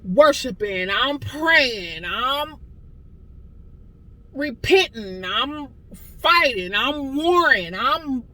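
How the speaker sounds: slow, emphatic list, each word pressed; fervent declaration. Real, not acted. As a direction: preach your fight with conviction. Declaring her spiritual battles.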